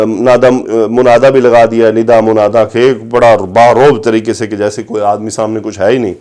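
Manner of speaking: ordinary talk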